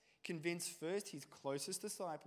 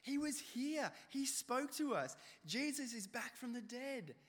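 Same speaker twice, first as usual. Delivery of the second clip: higher, slow, overjoyed insisting; excited disciples; 7 semitones higher than usual. Acted. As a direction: tell Thomas the wonderful news. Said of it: "they kept saying it".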